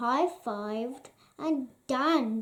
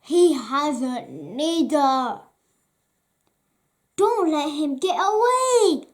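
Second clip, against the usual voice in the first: thief shouting; 3 semitones higher, 10 dB louder than usual. Acted. Thief ordering pursuit.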